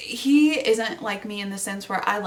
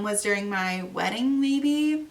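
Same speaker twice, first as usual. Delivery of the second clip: ordinary talk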